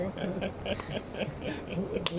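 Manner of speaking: short awkward laugh; a brief sheepish chuckle; embarrassed laugh. Real, not acted. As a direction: laugh it off, caught out. Caught not knowing the answer.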